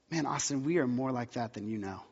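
soft and low, tenderly; a quiet, loving rebuke from within; gentle inner voice. Retold or imitated. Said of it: "the Holy Spirit said".

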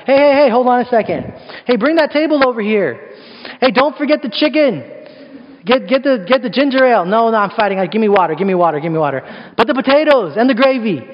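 hammed-up fighter calling for food; bossy excited fighter. Fighter in the octagon orders dinner brought, comic excitement.

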